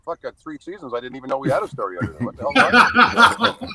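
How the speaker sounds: rising to a loud laughing what the hell; mock outrage that cracks into a laugh; incredulous outburst. Exasperated punchline to buddy.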